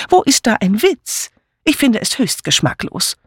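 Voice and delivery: high-pitched indignation; indignant. A narrator's reading. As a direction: protest in a high, offended voice. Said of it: "zitterten vor Empörung". (hoch und empört)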